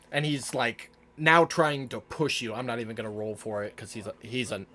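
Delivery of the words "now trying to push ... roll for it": ordinary talk, nothing marked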